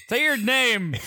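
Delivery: high, hyped-up call; hype shout. Acted. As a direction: hype the guest up. Hype demand for guest's name.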